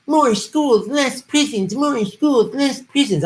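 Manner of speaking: high, singsong taunt; mock people in a high sneering chant; mocking Kamala. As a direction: jeer it in a high put-on voice. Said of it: "her mocking people that want more schools".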